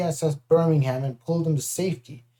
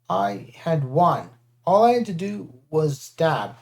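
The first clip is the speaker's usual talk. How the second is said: ordinary delivery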